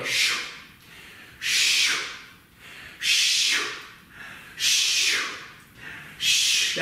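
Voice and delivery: soft shushing whoosh of a spinning dryer. Pretend. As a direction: breathe out a long sh like a machine turning. Tumble dryer turned on again.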